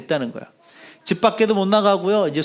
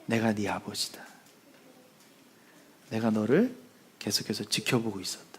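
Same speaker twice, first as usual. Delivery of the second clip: low, soft, solemn divine voice sinking to a whisper; voice of God; 9 semitones lower, 10 dB quieter than usual. Acted. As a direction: speak as God within, hushed and grave. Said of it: "음성이 들리더라".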